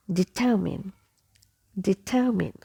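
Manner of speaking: "determine" as dih-TUH-min, evenly demonstrated; correct determine. Shown as a demonstration. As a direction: end "determine" on a short "min". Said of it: "So I will be pronounced as E".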